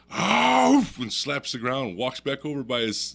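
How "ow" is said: big loud, high huffing bark; explosive animal huff, acted out; imitated bear woof. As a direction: let out a bear's parting woof. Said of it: "And he goes".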